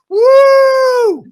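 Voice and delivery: high, loud whoop of joy; cheer. Retold or imitated. Boy hearing schools closed, loud high whoop. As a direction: whoop like a kid with a snow day.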